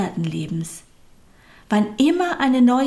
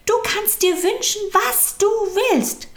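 much higher, bright eager call; 13 semitones higher than usual. A narrator's reading. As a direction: call it out high with delight. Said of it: "rief die kleine Seele". (hoch und begeistert gerufen)

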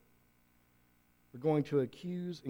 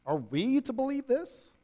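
higher, mocking disbelief; sarcastic; 9 semitones higher, 3 dB louder than usual. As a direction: toss it back with a sneer. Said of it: "They're being sarcastic with him".

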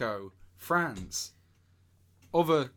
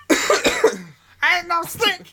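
high, loud goofy thug; 12 semitones higher, 11 dB louder than usual. Acted. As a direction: play a dim-witted henchman. Voicing thug.

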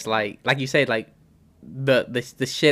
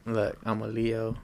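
low, slow seductive purr; slow, low and seductive, like a smooth-talking lover; sexy voice; 4 semitones lower, 11 dB quieter, slower than usual. Pretend. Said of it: "you put a sexy voice on".

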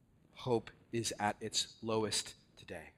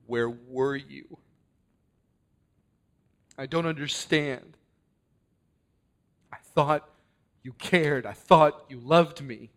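hurt; 2 semitones higher, 9 dB louder than usual. Acted. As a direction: let the hurt break through, building louder. Martha hurt, asking Jesus why.

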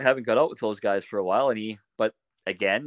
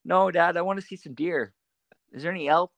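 put-on higher pleading child voice; lightly voiced young son; nagging little kid; 10 semitones higher than usual. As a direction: keep asking like a restless boy. Son quoted nagging dad.

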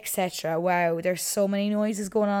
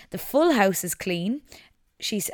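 normal talking voice

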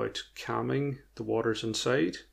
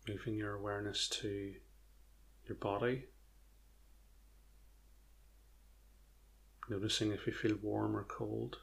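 guided meditation; 6 dB quieter than usual. Acted. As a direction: ease them gently into stillness.

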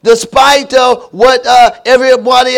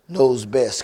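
hushed refrain; 9 semitones lower, 9 dB quieter than usual. Acted. Refrain said low and soft.

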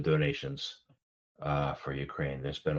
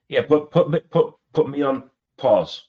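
playful loud joking; 10 semitones higher, 9 dB louder than usual. Real, not acted. Host jokes about being paused.

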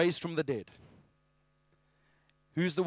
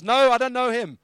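loud, high protest; heated denial; 8 semitones higher, 11 dB louder than usual. Acted. Peter denying Jesus to a bystander, heated. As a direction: deny it forcefully, voice raised.

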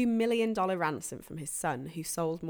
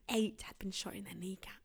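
hushed whisper; 10 dB quieter than usual. Acted. Grim news about victims.

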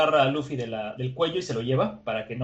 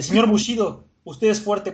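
begging plea; 7 semitones higher than usual. Acted. Igaram begging Zoro, high pleading voice. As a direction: beg the swordsman for help.

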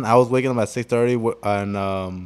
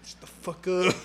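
imitating half-asleep; 6 semitones higher, 10 dB quieter than usual. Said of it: "like, still asleep".

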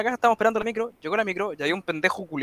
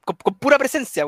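high, louder, amused telling; high amused punchline; 8 semitones higher, 4 dB louder than usual. Real, not acted. Punchline of funny robbery story.

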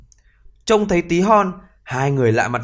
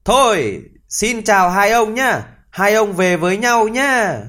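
higher, louder, slow teasing farewell; mocking; 8 semitones higher, 4 dB louder than usual. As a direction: taunt the two men from the hole. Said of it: "cười chế nhạo hai người kia".

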